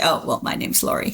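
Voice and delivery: hushed, almost whispered "Oh, well, my name's Lori."; soft aside. Shown as a demonstration. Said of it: "And then I can say".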